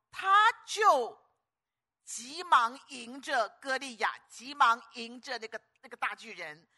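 loud, high sermon surge; full-force preaching. Acted. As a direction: build to the charge into the storm. Preaching the charge.

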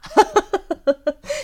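很高很响的爆笑; 乐得尖声大笑; 尖声大笑. Real, not acted. 发现摆的正是风水阵法. (尖声爆笑)

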